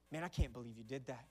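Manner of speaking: low-voiced quote of a bystander; retold stranger. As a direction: play the other guy, pitched well down. Voices another guy at the pump.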